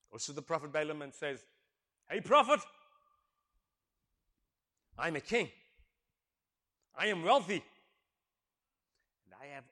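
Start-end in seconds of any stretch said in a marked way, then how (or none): 2.1-7.6 loud, proud king boasting; boastful king. Retold or imitated. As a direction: puff up like a rich king. Preacher voices boasting king.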